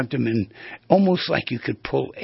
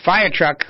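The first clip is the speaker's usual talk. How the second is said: "fire truck" punched louder and higher; emphatic; 7 semitones higher, 6 dB louder than usual. Shown as a demonstration. Host demonstrates a loaded reading to listeners, emphatic. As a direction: hit "fire truck" hard, full of urgency. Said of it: "that's one".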